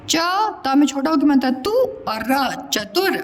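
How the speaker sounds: normal talking voice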